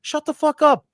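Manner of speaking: high, loud mock outrage; annoyed fans. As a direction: yell it like an exasperated heckler. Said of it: "it's ticking people off because they're like".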